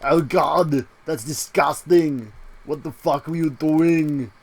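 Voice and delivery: put-on lightly done slow Schwarzenegger accent; a hint of Arnold, drawn out. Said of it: "break into a Schwarzenegger accent".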